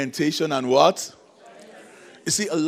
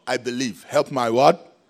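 loud plea prompt; 9 dB louder, slower than usual. Retold or imitated. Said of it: "He said".

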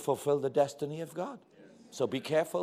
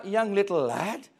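ordinary talk

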